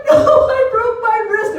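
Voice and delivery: high, wailed correction; hammed-up pained cry; distressed protest. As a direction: wail it high, as if hurt. Retold cry to husband after fall.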